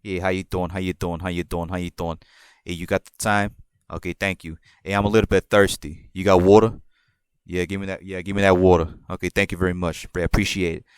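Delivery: low, flat New York style; New York accent. Shown as a demonstration. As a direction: talk like a laid-back New Yorker. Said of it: "that's how I speak".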